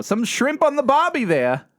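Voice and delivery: keyword Australian impression; Australian accent. Shown as a demonstration. Co-host warming up his accent, in put-on Australian. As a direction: ease into Australian with a stock phrase. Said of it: "keywords to, to get me into it".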